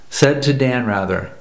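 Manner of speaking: ordinary delivery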